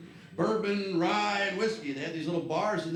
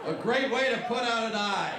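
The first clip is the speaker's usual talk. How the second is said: higher, louder cheery ad-voice; mock ad slogan; 7 semitones higher, 5 dB louder than usual. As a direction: sell it like a toy commercial. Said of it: "It said that right on the box".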